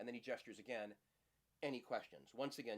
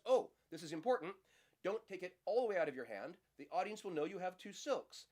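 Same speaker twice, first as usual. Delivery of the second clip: louder, higher, urgent; mock urgent warning; 7 semitones higher, 7 dB louder than usual. Magician feigning an urgent tip to the audience, louder. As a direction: warn them like it really matters. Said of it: "He says".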